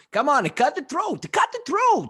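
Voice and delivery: high, loud urging shout; mock excited uncle yelling. Uncle urging goat slaughter.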